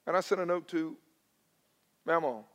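ordinary talk, nothing marked

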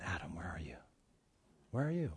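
very soft near-whisper; changed, gentle intonation. Shown as a demonstration. God calling his beloved Adam, gentle whisper. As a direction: call tenderly, hushed and searching. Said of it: "Let's change the intonation".

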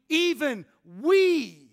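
"we" loud, long and swooping down; emphatic WE. Shown as a demonstration. Preacher driving home Paul's emphatic word, forceful stress on "we". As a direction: make "we" the slow, booming peak of the phrase. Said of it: "the emphasis is emphatic in the Greek".

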